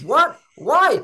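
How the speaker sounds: high, loud startled what and why; stunned disbelief at his no; wife's startled outburst; shocked wife. Retold or imitated. Wife shocked John refuses vet trip.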